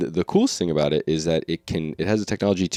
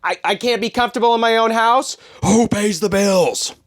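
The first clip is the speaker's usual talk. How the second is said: dad rant shout; 6 dB louder than usual. Pretend. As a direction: yell like an outraged father. Acting angry dad rant.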